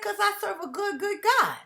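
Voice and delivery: high, sing-song retort of her own words; retold reply. Driver answering passengers, high sing-song retort. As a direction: lift it high like a cheerful comeback. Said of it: "I said".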